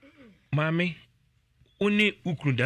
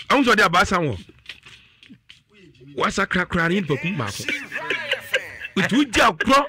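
louder and higher, pressing the warning on parents; heated warning; 8 semitones higher, 5 dB louder than usual. Real, not acted. Host warning parents on air about careless play, loud and insistent. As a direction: raise your voice to drive the point home.

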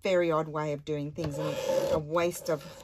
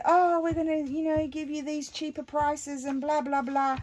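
mock salesman; 10 semitones higher, 3 dB louder than usual. Mocking energy salesman's pitch.

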